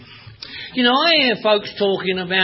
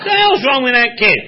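mocking grown-ups; 4 semitones higher than usual. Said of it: "they'd say".

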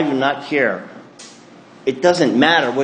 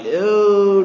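mock dismay; 8 semitones higher, 3 dB louder than usual. Dismayed at ugly sister.

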